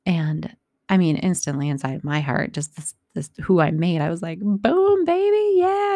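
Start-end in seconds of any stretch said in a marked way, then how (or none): 4.4-6.0 much higher, louder triumphant whoop; excited cheer. Real, not acted. Mom cheers inside at daughter's news, high, loud whoop. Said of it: "all excited for her".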